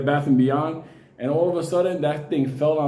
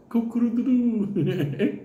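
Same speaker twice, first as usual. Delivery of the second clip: high, slow, laughing mock crow; rooster crow; 7 semitones higher, slower than usual. Comic mimicking the rooster at the ritual, hammed-up crow.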